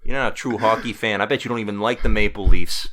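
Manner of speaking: loud hammed-up Canadian accent. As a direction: bluster like a hockey fan, Canadian accent. Mock Canadian teasing.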